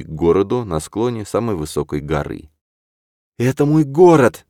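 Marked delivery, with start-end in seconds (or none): 3.4-4.4 high, loud, elated exclamation; inspired. A narrator's reading. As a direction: cry it out with joy. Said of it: "Вдохновлённая, окрылённая девушка".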